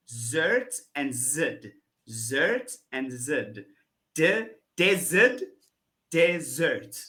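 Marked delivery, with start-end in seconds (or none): 0.1-3.7 Zert and zid said slowly and set against each other; contrasting syllables. Shown as a demonstration. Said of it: "instead of saying zid, you're gonna say zert".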